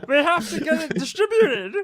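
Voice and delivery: high, strained Muppet-like voice, drawn out; hammed-up squeaky impression, slowly wailed; Jordan Peterson. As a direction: play him as an upset Muppet. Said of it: "Your Jordan Peterson's hilarious".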